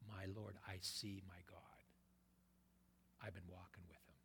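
hushed, reverent whisper of faith; hushed awe. Acted. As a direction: breathe the confession softly, in awe. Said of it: "and Thomas says".

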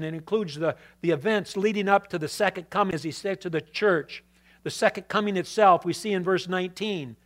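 normal talking voice

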